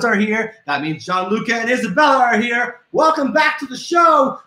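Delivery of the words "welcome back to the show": host cheerfully welcoming family back; excited welcome. Real, not acted. Greeting viewers loudly.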